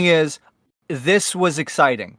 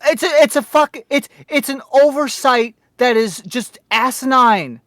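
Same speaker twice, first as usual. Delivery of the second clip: exasperated rant; 6 semitones higher, 4 dB louder than usual. Real, not acted. Ranting about the dub error.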